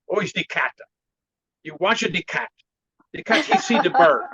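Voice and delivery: put-on Chinese-accented master telling a little tale; halting imitation accent, bursting on the pounce; imitated Chinese accent. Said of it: "I like your Chinese accent".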